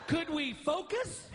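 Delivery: comedian imitating his wife hollering; high, drawn-out mock holler. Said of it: "she'd holler".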